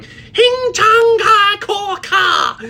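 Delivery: imitating a sudden, very high scream; screamed mock language. Coach retelling client's daughter in grocery store, screaming mock language. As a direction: shriek slow nonsense words at full volume, mimicking. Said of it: "my daughter starts going".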